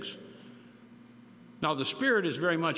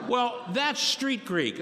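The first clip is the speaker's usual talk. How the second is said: slow emphasis; 8 semitones higher, much slower than usual. Real, not acted. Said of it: "slow emphatic punchline".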